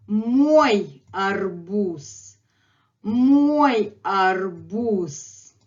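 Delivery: very slow, drawn-out "мой арбуз"; slow model phrase. Shown as a demonstration. Teacher models the other phrase, very slowly and deliberately. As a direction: stretch "мой" and "арбуз", ending on a voiceless s. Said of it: "here is the other phrase".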